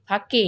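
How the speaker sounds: फाके said with a long open a, faa-kay, the wrong way; फाके as a two-syllable faa-kay mistake; wrong reading faake. Shown as a demonstration. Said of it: "ऐसे नहीं पढ़ेंगे".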